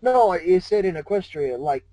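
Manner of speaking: ordinary delivery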